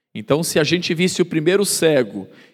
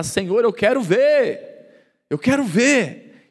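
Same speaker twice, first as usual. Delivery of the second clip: slow, higher begging cry; pleading blind man; 4 semitones higher, slower than usual. Retold or imitated. Blind man begging Jesus to see, slow, raised plea. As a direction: plead slowly for sight.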